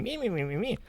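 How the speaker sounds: high, soft, singsong mock babble; mocking mimicry. Elizabeth mocking her other self on TV, high-pitched mockery. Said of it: "mocking herself, like, anything she says".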